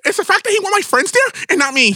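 high put-on voice of the friend; imitating her friend. Mocking the friend's complaint, high imitation. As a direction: mimic the friend, high and whiny. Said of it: "That's exactly how she talks".

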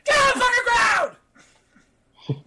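high, loud yell; frantic armed-guard holler; guard's shouted order. Acted. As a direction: scream it like an armed guard taking control. Guard brandishes firearm at students.

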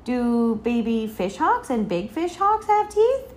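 put-on high child's question, rising at the end; wondering little-girl query; curious little girl. A narrator's reading. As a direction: ask eagerly, pitch climbing. Curious child questioning her father.

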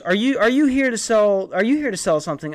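high, loud, rapid suspicious mom; accusing mom. Retold or imitated. Mom at the door grilling the salesman, loud and high. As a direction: fire the question at him like an angry mother.

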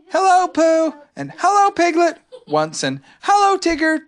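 a tiny joey greeting everyone; little Roo. Acted. Roo greeting his friends, high and excited. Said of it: "when Roo had said".

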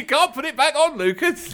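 high mock-pleading; hammed-up panicked barber; mock plea.

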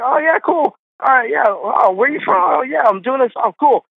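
high, eager mock small talk; imitated chummy agreement. Friend agreeing to a picture with a stranger, high imitated eagerness. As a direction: mimic someone happily obliging a fan.

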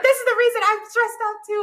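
high, loud mock stressed outburst; loud, high-pitched acted-out frustration; retold exasperation. Acting out her exasperated inner argument.

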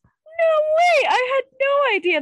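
high, loud astonished cry; surprised. Real, not acted. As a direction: gasp out real amazement. Surprised by fun fact.

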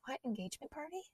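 high, hushed little reply; smitten mock innocence. Comic acting her smitten reply to suitor, high and coy. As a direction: answer very softly in a high, coy voice.

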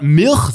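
Milch turned into "Mirch", drawn out; mispronounced Milch. Retold or imitated. Podcaster quoting what he hates hearing, mispronounced Milch. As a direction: drop the L in Milch, making it "Mirch". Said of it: "Wenn jemand Milch sagt".

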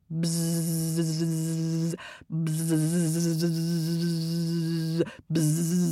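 long low buzz; sustained droning bee noise. A narrator's reading. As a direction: buzz like a hive full of bees. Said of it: "give me a bit of buzzing".

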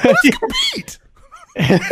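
very high falsetto, breaking into laughs; squeaky plea through laughter. Retold or imitated. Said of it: "He said it like that".

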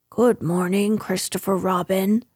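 ordinary delivery, nothing marked

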